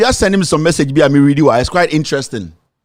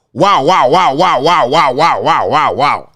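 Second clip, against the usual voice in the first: drawn-out wows sinking much lower each time; sarcastic wow that drops lower and lower; mock amazement; 3 semitones lower, slower than usual. Real, not acted. Commentator mocking the party's candidate list, sarcastic falling wows.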